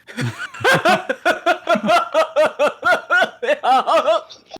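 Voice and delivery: high, loud giggling fit; long loud laughing fit. Real, not acted. Reader losing it while reading the giggle, loud laughter. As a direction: break into helpless giggles.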